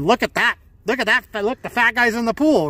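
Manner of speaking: mocking onlookers. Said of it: "people were going".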